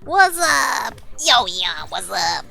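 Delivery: a squeaky little gremlin greeting. Acted. Streamer yelling hi to a chatter in a gremlin voice. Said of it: "it sounded like a little bit of a gremlin".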